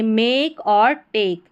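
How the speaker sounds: मेक and टेक with ए stretched long; slow मेक, टेक with held "ay"; long vowel, corrected. Shown as a demonstration. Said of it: "नहीं बोलेंगे".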